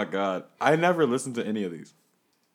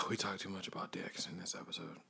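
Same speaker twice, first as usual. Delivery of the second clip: whisper; 7 semitones lower, 14 dB quieter, faster than usual. Real, not acted. Sighed whisper aside.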